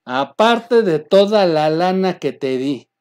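slow, loud, leaning on every word; pointed repeat. Retold or imitated. Host repeats the politician's words to viewers, slow and emphatic. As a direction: hammer home the quote from the audio, slowly.

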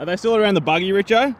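calling out across the course. Real, not acted. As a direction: shout the question to a mate far off.

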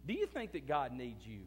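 normal talking voice

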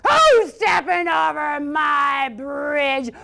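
slow, drawn-out roaring shout; troll's roar. Acted. Troll challenging the biggest goat, roaring. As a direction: roar it out, stretching every word. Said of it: "roared the troll".

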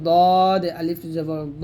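final দ full and rounded, held long; thick, heavy letter. Shown as a demonstration. Teacher showing a heavy letter, দ thick and drawn out. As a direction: say দদ heavy and stretch the দ. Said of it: "মোটা হবে".

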